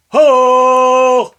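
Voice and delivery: high shouted cry; battle cry. Acted. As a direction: belt it like a war whoop.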